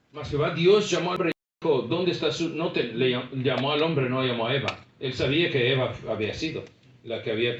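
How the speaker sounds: full-force sermon delivery; fervent preaching. Acted. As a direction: preach it hard to the congregation.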